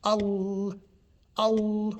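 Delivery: "Al", the L drawn out; emphasised lam. Shown as a demonstration. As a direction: lean hard on the L of "Al". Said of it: "put an emphasis on the lam".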